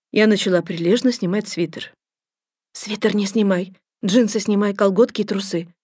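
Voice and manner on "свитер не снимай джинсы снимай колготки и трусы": plain reading voice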